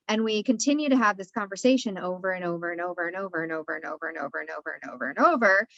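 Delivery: an endless loop, tumbling out quickly; rushed repetition. Acted. Guest describing endless talk with an emotion, rushed repeating.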